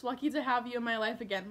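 ordinary delivery

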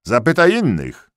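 high, loud threatening call; loud threat. A narrator's reading. Tyrolean soldier threatening the doubter, loudly. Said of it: "odgrażał się na głos".

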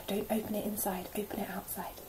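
soft, secret whisper, don't open it inside; whispered warning. Shown as a demonstration. Teacher to nursery, whispering the umbrella rule. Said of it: "But remember".

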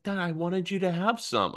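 put-on high, small boy voice, a sweet four-year-old; little son. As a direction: voice the son handing over his gift. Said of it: "He's like".

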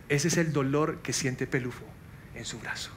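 a quiet, low voice from above; voice of God. Retold or imitated. God answering the pastor's prayer, low and hushed. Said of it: "Y el Señor me dijo".

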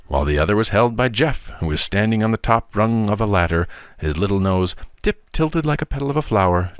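ordinary delivery, nothing marked

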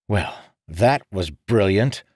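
slow, dry sarcasm; sarcastic snap. Acted. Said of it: "Marin snapped".

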